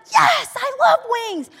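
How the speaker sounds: put-on high, slow, shouted kid cheer; excited boy. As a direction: imitate a thrilled child yelling. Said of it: "one of the children, the gold chain boy".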